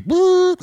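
high, loud electronic-style boop; imitated alert tone. Host mimics the emergency alert system, high loud beep. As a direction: hoot one piercing test tone.

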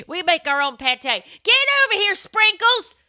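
high, loud hammed-up host voice; backwoods host. Airbnb host calling her pit bull, loud mock holler.

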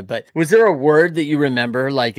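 ordinary talk, nothing marked

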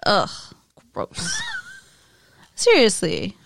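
grossed-out groan; disgusted ugh, high squeak on gross; annoyed ugh. Real, not acted. Disgusted at Dylan smelling fingers.

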